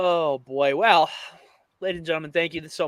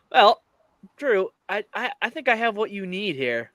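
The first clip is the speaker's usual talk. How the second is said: normal talking voice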